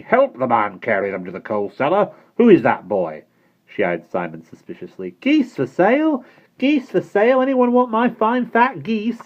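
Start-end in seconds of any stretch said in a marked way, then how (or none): 0.0-3.2 louder, hard commanding voice; stern headmistress. Acted. Missus Brisket ordering Bonnie, stern and suspicious. 5.2-9.2 very high, loud and slow street call; calling out wares. Acted. Simon hawking his geese to Missus Brisket, loud and drawn out. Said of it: "he called".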